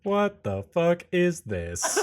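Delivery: slow, high, incredulous drawl; baffled disbelief. Acted. Tempest looks down at the handcuffed frog, baffled. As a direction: stretch it out in total bafflement.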